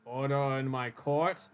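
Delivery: slow, drawn-out judge voice; lightly voiced judge, slowly calling order. Judge quieting the court, slow measured command.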